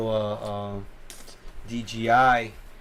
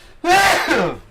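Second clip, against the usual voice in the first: sudden sharp sneeze; a real explosive sneeze; loud sneeze; 8 dB louder than usual. Real, not acted. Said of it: "Sorry, I sneezed really loud".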